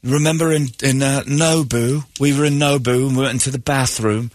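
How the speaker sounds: lightly put-on female voice reminding him; imitating the woman. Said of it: "And she goes".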